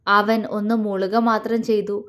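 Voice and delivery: plain reading voice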